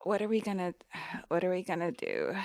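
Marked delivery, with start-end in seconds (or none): none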